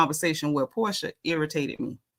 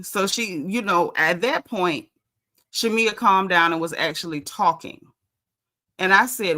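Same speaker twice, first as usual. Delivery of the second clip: ordinary talk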